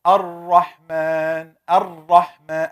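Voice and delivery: Ar-Rahman drawn out, ra held; slow model of Ar-Rahman for practice; Arabic ra drill. Shown as a demonstration. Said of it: "Everybody try it".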